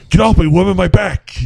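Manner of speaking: high pained yelp; Will Ferrell yelp. Retold or imitated. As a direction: yelp it like a hurt back. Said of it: "he, he".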